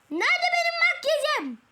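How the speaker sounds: squealing mock panic; frantic. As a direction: panic about the missing makeup. Frantic search for makeup.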